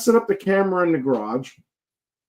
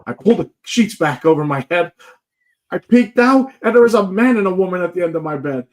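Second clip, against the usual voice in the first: mom's excited account; 6 semitones higher, 5 dB louder than usual. Retold or imitated. Retelling mom's alarmed account.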